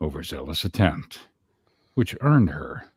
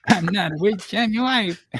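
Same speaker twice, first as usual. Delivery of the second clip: squeaky falsetto imitation; high mock voice; 4 dB louder than usual. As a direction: repeat it high and silly.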